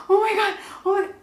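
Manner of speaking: high, frantic "oh my God" in retold panic; panicked. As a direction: replay the panic as you cling to the tube. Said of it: "I'm having a massive panic attack".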